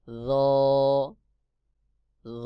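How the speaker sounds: crisp র with the tongue tip at the teeth; letter demo. Shown as a demonstration. Teacher showing students the letter র. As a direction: say র clearly, teacher style. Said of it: "যেমন".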